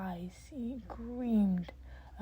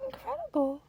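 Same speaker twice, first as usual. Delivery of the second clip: much higher, louder astonished cry; amazed exclamation; 13 semitones higher, 5 dB louder than usual. Acted. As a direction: gasp it out in wonder. Nezu amazed.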